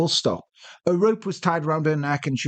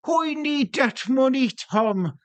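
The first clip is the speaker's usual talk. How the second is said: higher, drawn-out mock Irish; hammed-up fake Irish, stretching the words; badly faked Irish accent; 10 semitones higher, slower than usual. Brad Pitt in The Devil's Own parody, bad Irish accent. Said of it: "Bit like Brad Pitt in The Devil's Own".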